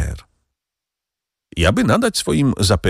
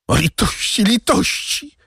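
pleading cry climbing much higher; crying for mercy. A narrator's reading. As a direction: beg desperately for mercy. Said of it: "krzyczał Kadrus".

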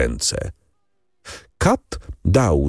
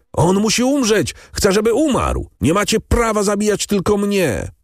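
much higher, desperate screaming; frantic scream; 14 semitones higher than usual. A narrator's reading. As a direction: scream it out of your mind with terror. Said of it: "Skazany wrzeszczał bez przerwy". (skazaniec wrzeszczy bez przerwy, szarpiąc się z katami)

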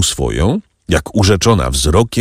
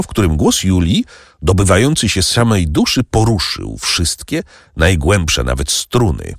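usual speaking voice

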